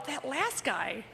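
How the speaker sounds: quiet confiding murmur; hushed aside. Retold or imitated. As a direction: lean in and say it under your breath. Whispering to soldier at ceremony.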